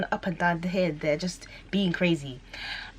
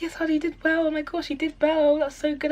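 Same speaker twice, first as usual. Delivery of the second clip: high, sweet gushing praise; gushing friends; 11 semitones higher, 4 dB louder than usual. Retold or imitated. Friends praising her results, high gushing coo. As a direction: coo it like proud aunties. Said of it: "they were like".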